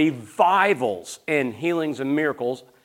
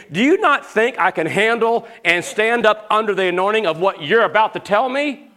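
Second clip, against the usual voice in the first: raised, higher-pitched rhetorical question; indignant challenge; 7 semitones higher, 4 dB louder than usual. Retold or imitated. Preacher voicing his offended inner reply to his friend, indignant. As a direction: push it out insistently, rising to the end.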